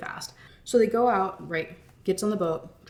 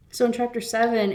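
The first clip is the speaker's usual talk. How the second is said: normal talking voice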